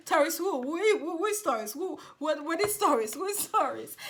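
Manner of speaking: loud, high mock bewilderment; exaggerated loud who-is-this voice; mocking confused friends. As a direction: ham up puzzled strangers. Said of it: "friends who don't know you".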